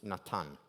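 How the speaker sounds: "Natan" said nah-TAHN, both a's open as in father; "Natan" in Hebrew, not English Nathan, no th sound, stress on the last syllable; "Natan" with a clean t and open ah vowels, as a Hebrew speaker says it; Hebrew pronunciation. Shown as a demonstration. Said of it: "You know the name Nathan? In Hebrew, it's".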